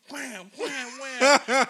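high, loud whams building to a yell; imitated blows.

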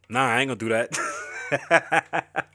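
slow, laughing refusal; uncle laughing. Retold or imitated. Uncle refusing his wife's request through laughter. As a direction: chuckle out a lazy no. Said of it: "my uncle's like".

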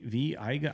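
Viega with an EYE sound, vee-EYE-guh; second pronunciation attempt. Shown as a demonstration. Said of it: "how do you say".

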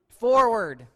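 loud, drawn-out command; God ordering Moses on, a loud forward. Retold or imitated. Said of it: "context, God".

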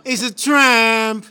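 slow crooner line, drawn out. Pretend. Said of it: "acting like he's fucking [name]".

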